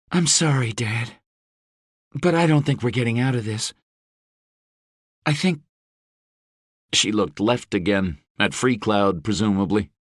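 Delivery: a lightly voiced daughter, anxious; Rose. A narrator's reading. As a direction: Rose telling her father the situation is grim.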